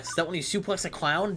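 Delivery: usual speaking voice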